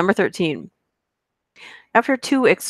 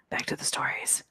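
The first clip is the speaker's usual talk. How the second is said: breathy whisper, no voice at all; 14 dB quieter than usual. Real, not acted. Whispering to viewers to get back to the stories. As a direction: drop to a secretive whisper.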